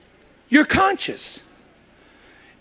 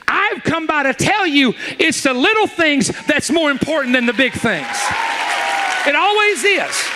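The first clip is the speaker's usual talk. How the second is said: full-force preaching; 5 semitones higher than usual. Acted. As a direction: drive the point home to the crowd. Preaching the point to congregation.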